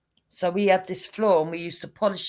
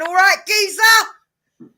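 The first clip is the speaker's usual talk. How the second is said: slow, higher, louder London geezer greeting; cockney greeting; 11 semitones higher, 10 dB louder, much slower than usual. Retold or imitated. Stranger greeting the husband outside the shop, in a slow Cockney voice. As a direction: drawl it like a cheeky East End bloke. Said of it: "the bloke come out and went".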